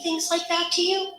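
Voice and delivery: high-pitched, rising retold disbelief; incredulous question. Hotline woman questions caller, retold incredulous question. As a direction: act out a listener's astonished check.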